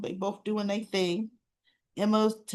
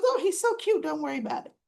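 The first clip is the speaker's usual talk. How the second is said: cooing; 7 semitones higher, faster than usual. Retold or imitated. Laura cooing over the dog.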